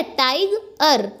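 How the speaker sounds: Tiger slowly broken as "taig - er"; two slow, separate beats on tiger; split into syllables. Shown as a demonstration. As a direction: pause inside tiger, between "taig" and "er". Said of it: "एक है टाइग और एक है अर".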